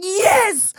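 very high, mock-mad yelp; exaggerated kid outburst. Frustrated kid answers mom, high and exaggerated. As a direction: play an indignant child. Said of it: "the most exaggerated shit I could".

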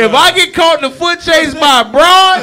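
loud, high and laughing; laughing shout. Real, not acted. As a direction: yell it while cracking up. Laughing shout about foot chase.

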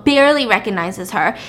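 ordinary delivery

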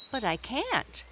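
high, quick protest. Acted. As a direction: push back a little. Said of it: "protested Peter".

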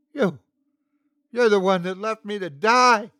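high, loud accusing scream; hoarse scream. Acted. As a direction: hurl the blame at him, screaming. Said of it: "she screamed hoarsely".

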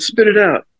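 high-pitched mock order; retold impatient demand. Pitcher demanding the stammering heckler talk, mock impatience.

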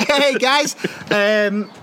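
ordinary talk, nothing marked

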